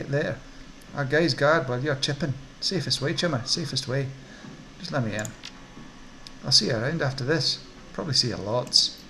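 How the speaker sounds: swooping Scottish orc salesman; Scottish accent. Acted. Orc dealer explaining his lab to a customer, lilting Scottish accent. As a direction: pitch it with a sing-song Scottish lilt. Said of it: "Don't know why he's Scottish".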